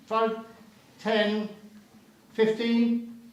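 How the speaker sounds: brisk auction calling, counting up bids; auctioneer. Real, not acted. Auction bidding calls.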